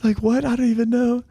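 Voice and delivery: hammed-up baffled kid; mock clueless. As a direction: squeak out the confusion. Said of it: "They're like".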